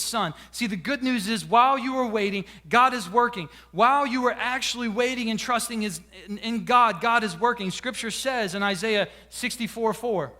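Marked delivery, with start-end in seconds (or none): none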